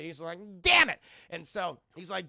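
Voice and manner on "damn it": loud, high cartoon yell; frustrated shout. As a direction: belt it out in frustration. Victim shouts in retold cartoon.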